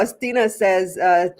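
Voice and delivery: ordinary talk, nothing marked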